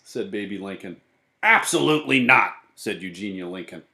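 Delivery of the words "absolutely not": loud, higher, indignant no; loud flat-out refusal; emphatic refusal. A narrator's reading. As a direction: refuse it firmly and forcefully. Eugenia refuses the invitation.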